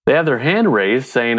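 ordinary delivery

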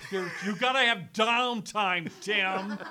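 laughing loudly through the words; loud laughing protest. Real, not acted. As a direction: crack up while defending it. Laughing defense of long lunches.

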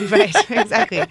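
high, loud laughing agreement. Real, not acted. Laughing at friend's joke.

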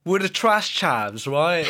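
slow, hammed-up Cockney; Cockney accent. Host clowning as a British chav, mock Cockney. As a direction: put on a drawn-out mock Cockney. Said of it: "the Cockney voice".

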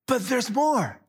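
excited sales pitch; infomercial voice. Acted. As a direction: hype it like a TV ad. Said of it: "I sound like an infomercial".

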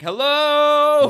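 shouting hello into an empty city; high, loud call into the emptiness; retold scream. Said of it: "Just screaming".

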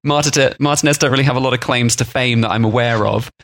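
ordinary talk

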